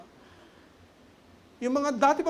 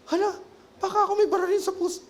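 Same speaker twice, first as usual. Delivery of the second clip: fast, higher, retold alarm; hurried half-joking panic; mock panic; 9 semitones higher, 4 dB louder, faster than usual. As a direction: rush it like sudden fear of a heart attack. Retells panic during testimony.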